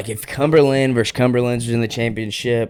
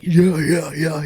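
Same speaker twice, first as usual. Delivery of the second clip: higher-pitched mock "yeah, yeah, yeah"; put-on, raised-pitch imitation; imitated yeahs; 7 semitones higher, slower than usual. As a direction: act out their repeated yeahs, pitched up. Imitates what others were saying.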